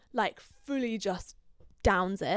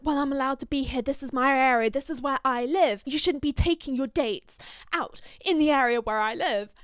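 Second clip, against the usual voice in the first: louder, higher, fast and defiant; the ex-wife snapping at him, higher and louder; indignant retort; 5 semitones higher, 5 dB louder than usual. Retold or imitated. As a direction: snap back that this is her turf. Ex-wife argues back at him.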